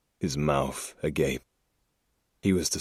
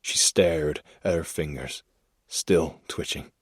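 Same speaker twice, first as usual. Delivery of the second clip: usual speaking voice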